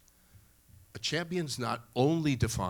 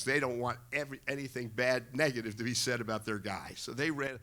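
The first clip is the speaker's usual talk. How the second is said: usual speaking voice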